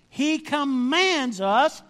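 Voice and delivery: slow and high, COMMANDS driven home; stress on COMMANDS. Real, not acted. Preacher says it is no mere suggestion, emphatic COMMANDS. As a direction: hit COMMANDS loud and high, stretching it out.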